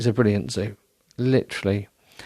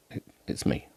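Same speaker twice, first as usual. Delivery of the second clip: whisper; 8 dB quieter than usual. Real, not acted. Whispered aside after accent.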